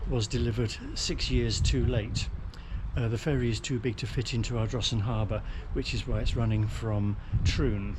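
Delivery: ordinary talk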